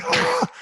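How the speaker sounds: a sudden high squealed "ah" of fake pain; mock pained yelp. Teller acts out the teammate going down in pregame with a mock high yelp. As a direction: yelp like a player faking a sprained ankle.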